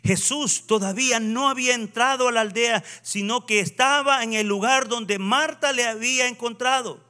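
high, emphatic pulpit delivery; preaching. Real, not acted. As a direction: proclaim the story to the congregation. Preaches with raised voice.

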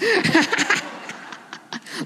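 long, high breathy giggling; real laugh. Real, not acted. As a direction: crack up at your own joke. Laughs at her own joke.

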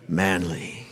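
deep, quiet mock-macho voice; manly voice. Preacher joking on Andrew's name, deep manly voice. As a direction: drop it low, playfully manly.

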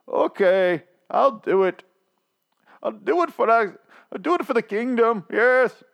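high, put-on reluctant king; resigned king voice. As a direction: agree grudgingly, like a sulking monarch. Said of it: "And he's like".

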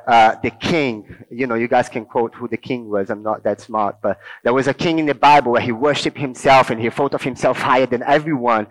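ordinary talk

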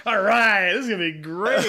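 loud opening, breaking into laughter; gleeful anticipation. Retold or imitated. Driver rolls window down for a fight, gleeful. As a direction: relish the fight about to start.

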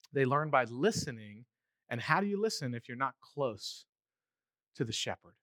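ordinary talk

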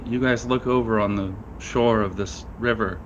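ordinary talk, nothing marked

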